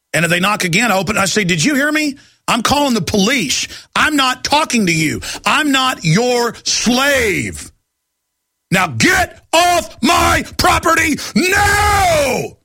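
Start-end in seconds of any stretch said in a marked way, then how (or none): 1.5-12.6 mounting fury that breaks into a shout on the last line; angry yell. Retold or imitated. Yelling at census taker at door.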